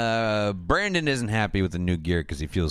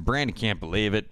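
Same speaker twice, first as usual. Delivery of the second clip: normal talking voice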